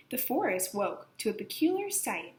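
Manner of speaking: ordinary delivery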